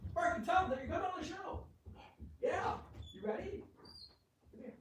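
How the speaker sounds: high, distant call to a dog; quick high call, far from the mic. Real, not acted. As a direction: call the dog from another room. Calls dog from away from the microphone.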